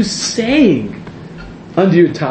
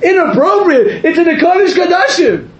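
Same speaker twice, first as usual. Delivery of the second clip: amused outrage; 10 semitones higher than usual. Real, not acted.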